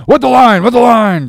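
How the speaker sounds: high, very loud urgent shout; Stubb crying out. A narrator's reading. Stubb to the tub oarsman, loud cry. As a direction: cry the order out, twice over. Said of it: "cried Stubb to the tub oarsman".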